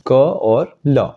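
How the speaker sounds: क and ल said apart, slowly; slow letter-by-letter split. Shown as a demonstration. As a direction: break कल into क and ल, slowly. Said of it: "अगर मैं कल बोल रहा हूँ".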